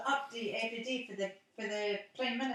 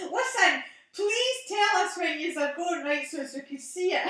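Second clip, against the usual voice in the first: laughing plea; 7 semitones higher, 7 dB louder than usual. Real, not acted. Asking the friend to tell her when they go out, laughing. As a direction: beg through the giggles.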